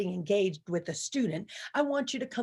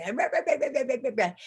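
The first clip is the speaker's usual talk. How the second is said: mock yelling teacher; 3 semitones higher, much faster than usual. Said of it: "they start yelling".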